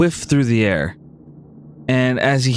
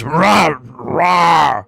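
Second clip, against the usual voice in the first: half-hearted beast growl, a bit raised; badly faked roar; 3 semitones higher, 3 dB louder than usual. Buffalo playing along to fool Tyke, badly faked roar. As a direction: roar like a poor actor. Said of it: "his acting's kinda shitty".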